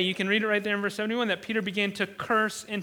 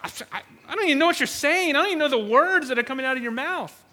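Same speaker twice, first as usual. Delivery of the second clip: fast, higher, mock-baffled denial; feigned ignorance; 4 semitones higher, 4 dB louder, faster than usual. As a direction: play dumb in a rushed stammer at first. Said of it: "the ignorance that he's playing here".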